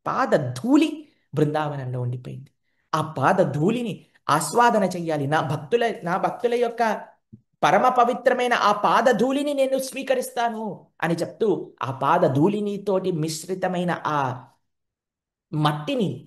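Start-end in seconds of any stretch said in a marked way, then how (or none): 5.3-10.9 divine Krishna. Acted. Krishna speaks as god.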